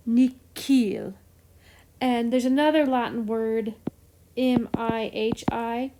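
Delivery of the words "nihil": "nihil" said nee-keel. Shown as a demonstration. Teacher shows a Latin oddity, "nihil" with K. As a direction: say the H in "nihil" as a hard K. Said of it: "pronounced as a K".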